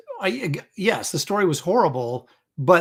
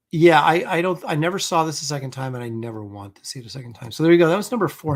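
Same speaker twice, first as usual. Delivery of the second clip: ordinary talk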